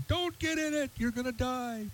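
high, held-back yell, kept soft; mock yelling. Viewer yells at the TV to warn contestant, high muted mock yell. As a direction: warn them in a strained, high mock scream. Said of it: "And I'm yelling out".

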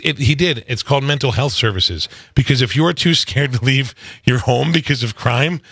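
normal talking voice

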